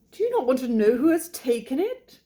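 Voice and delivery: high, loud, impatient British wife; impatient cry. Acted. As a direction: burst out, impatient to tell. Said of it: "cried his wife impatiently".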